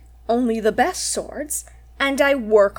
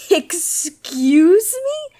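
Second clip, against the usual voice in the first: offended disbelief; 3 semitones higher, much slower than usual. Acted. Bandit insulted by lord.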